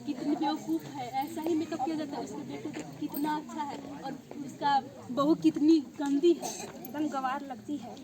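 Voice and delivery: low, soft grumbling; disparaging undertone. Acted. Visitor grumbling about the dirty daughter-in-law, low disparaging undertone. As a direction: keep it low and private, with disdain.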